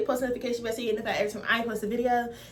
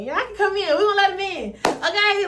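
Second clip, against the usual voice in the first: higher, louder excited call; excited welcome; 7 semitones higher, 4 dB louder than usual. Acted. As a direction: call them in brightly. Repeats, second time louder higher.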